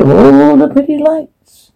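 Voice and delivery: high eerie demonic voice; sing-song possessed whisper-lilt. Acted. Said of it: "demonic voice".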